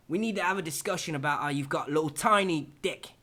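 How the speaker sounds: hammed-up Aussie review voice; Ozzy Man Australian accent. Roasting a Game of Thrones picture in a mock Australian accent. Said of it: "do the accent".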